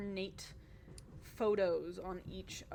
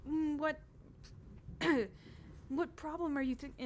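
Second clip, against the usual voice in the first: ordinary talk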